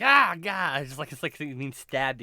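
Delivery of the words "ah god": loud cry of pain; pained yelp. Retold or imitated. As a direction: yelp it out sharply. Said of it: "and I'm like".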